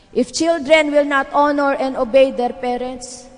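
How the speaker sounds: slow, loud sermon emphasis; forceful preaching. Real, not acted. Preacher stressing children's duty.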